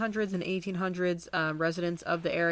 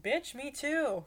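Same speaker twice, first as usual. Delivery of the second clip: sassy; 7 semitones higher, slower than usual. Pretend. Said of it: "I'd be like".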